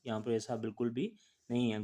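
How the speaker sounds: ordinary delivery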